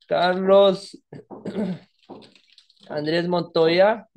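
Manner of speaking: slow, drawn out, word by word while typing; slowly dictating the name to the class; slow dictation. Shown as a demonstration. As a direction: spell the name out as you type it. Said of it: "vamos a colocarle".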